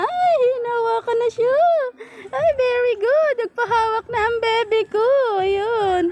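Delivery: high cooing praise. Real, not acted. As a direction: gush over the pet in a high voice. Praising the pet.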